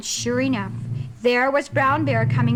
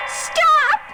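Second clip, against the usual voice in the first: high, louder pleading scream; 9 semitones higher, 3 dB louder than usual. Acted. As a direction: shriek to save him. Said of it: "she screamed as loud as she could".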